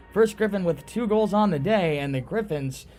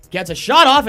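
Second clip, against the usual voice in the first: goal call yell; 7 semitones higher, 10 dB louder than usual. Real, not acted. Goal call by play-by-play announcer.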